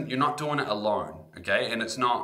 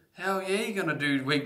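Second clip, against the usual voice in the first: enthusiastic; 9 semitones higher than usual. Real, not acted. Emphatic answer to own question.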